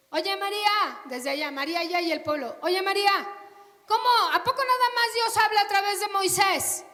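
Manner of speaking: Aaron appelle María, voix forte et haute; calling out. Acted.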